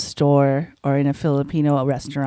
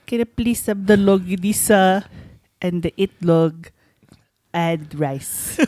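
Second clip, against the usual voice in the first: Filipino accent. Shown as a demonstration. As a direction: order in a put-on Filipino accent. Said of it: "if I flip it and be like".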